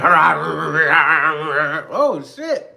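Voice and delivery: high, loud startled yelp; mock surprised shout. Driver startled by the car, high loud mock alarm. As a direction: play the shocked driver who can't believe it.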